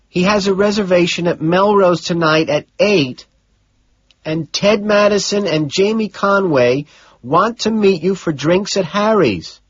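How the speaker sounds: a secretary passing on messages; Gene's voice. Acted. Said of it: "Gene says".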